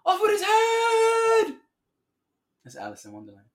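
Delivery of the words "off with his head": high, loud, drawn-out mock scream; hammed-up royal shriek. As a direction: shriek it like a storybook queen. Acts the queen from Alice.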